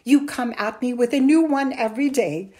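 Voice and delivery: loud, heated list of complaints; exasperated scolding. A narrator's reading. Mother lists her son's lies, exasperated. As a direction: reel off each tall tale in exasperation.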